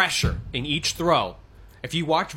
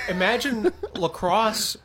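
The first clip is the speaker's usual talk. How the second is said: laughing; 7 semitones higher, 3 dB louder, slower than usual. Real, not acted. Laughing while explaining hurling.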